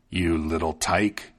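a lightly voiced rough robber; gruff thief. A narrator's reading. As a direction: thief sneering at the tiny boy. Thieves mock the tiny boy.

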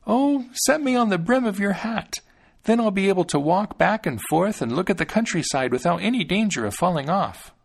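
put-on high-pitched tiny child voice; eager, confident Thumbling. A narrator's reading. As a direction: pipe up confidently as a tiny boy.

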